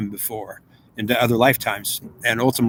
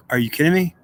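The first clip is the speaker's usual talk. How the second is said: pitched up in disbelief, a rising question; stunned disbelief; 5 semitones higher than usual. Retold or imitated. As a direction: react like you can't believe what you just heard. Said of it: "And I'm like".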